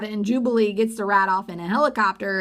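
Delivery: usual speaking voice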